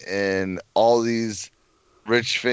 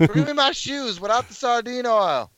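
mock diva voice. Mocking Cage playing Joe Exotic.

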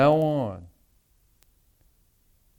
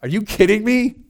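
higher and louder, incredulous disbelief; exasperated; 8 semitones higher, 7 dB louder than usual. Shown as a demonstration. Jesus to Philip, openly exasperated and incredulous. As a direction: throw up your hands: are you kidding me. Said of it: "this is exasperation".

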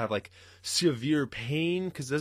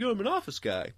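mocking office guy; 8 semitones higher, 3 dB quieter than usual. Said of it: "He's like".

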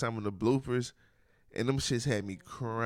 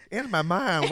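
imitating the singer; 5 semitones higher, 3 dB louder, slower than usual. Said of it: "why is he saying it so strong like that".

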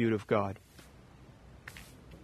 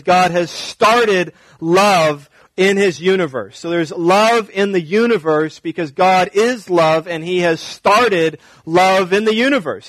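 higher and louder, pressing each line; impassioned preaching; 4 semitones higher, 3 dB louder than usual. Real, not acted. Pastor to congregation about God's love, preaching hard. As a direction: preach it with rising fire. Sermon builds to full force.

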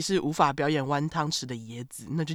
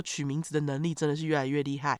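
normal talking voice

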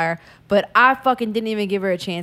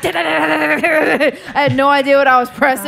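real laughter through the words, higher and louder; laughing; 6 semitones higher, 5 dB louder than usual. Real, not acted. Friend admitting she button mashed her way to victory, laughing. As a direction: giggle while admitting it.

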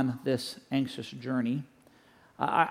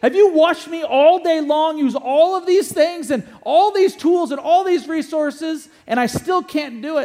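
much higher, louder, exasperated retelling; exasperated outburst; 13 semitones higher, 11 dB louder than usual. Retold or imitated. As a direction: explode at your father in disbelief. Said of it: "the son explodes on him".

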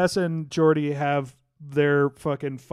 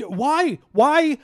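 exasperated shout; 10 semitones higher, 4 dB louder than usual. Real, not acted. Exasperated rant at writers.

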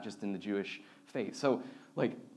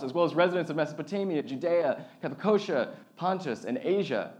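projected Bible reading, pitched up in places; scripture reading; 4 semitones higher, 3 dB louder than usual. Acted. Pastor reads the Pentecost story to the congregation, projected reading voice. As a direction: read the story out to the whole room. Reads list of nations aloud.

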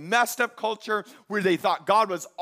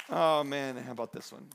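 usual speaking voice

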